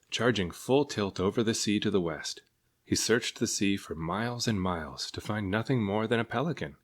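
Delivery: ordinary delivery, nothing marked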